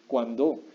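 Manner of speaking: Cuándo stressed on the last o, cuan-DÓ; Cuándo said as kwan-DOH; wrong final stress. Shown as a demonstration. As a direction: push the stress to the end of Cuándo. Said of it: "No, no cuándo".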